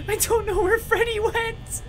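high, anxious blurt; panicked high. Real, not acted. Player alone at night losing Freddy, panicked high.

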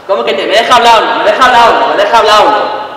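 loud, repeated badgering; insistent demand. Boyfriend demanding to be allowed to talk, insistent. Acted quarrel, demanding to speak.

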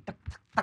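soft, voiceless clicking hits; imitated stick taps. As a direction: tap out the blows with your mouth.

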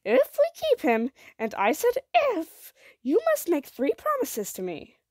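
insistent, heavily stressed ultimatum; stern conditions. A narrator's reading. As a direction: lay down the rules forcefully, point by point. Mother lays down strict conditions.

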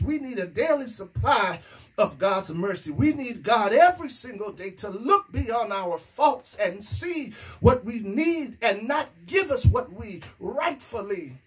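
ordinary talk, nothing marked

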